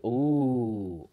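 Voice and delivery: normal talking voice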